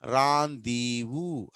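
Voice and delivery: రాందీవు slowly, in three long syllables; careful, stretched రాందీవు; model pronunciation. Shown as a demonstration. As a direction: say రాందీవు as "raan-dee-voo". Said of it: "అని పలకాలి".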